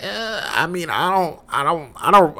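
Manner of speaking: high-pitched, hesitant stammer; reluctant hedging. Acted. Roommate dodging a couple's argument, reluctant high hedging. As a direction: squirm out of answering, voice up high.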